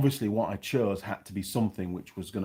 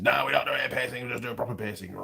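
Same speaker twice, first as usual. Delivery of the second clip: higher, mock phone refusal in a put-on voice; put-on shop voice; 3 semitones higher, faster than usual. Piercing shop turning down a caller, mock put-on voice. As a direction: imitate the curt refusal from the piercing place.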